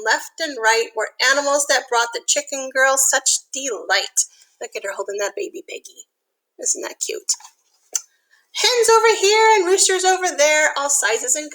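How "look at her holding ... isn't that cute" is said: soft, hushed "isn't that cute"; soft cooing whisper; cooing aside. Real, not acted. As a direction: coo over the cute piglet picture. Cooing aside over piggy picture.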